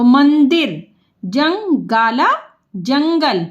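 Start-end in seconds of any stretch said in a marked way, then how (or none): 1.2-2.3 जंगाला drawn out part by part; slow sounding out. Shown as a demonstration. Teacher to pupils, breaking जंगाला apart.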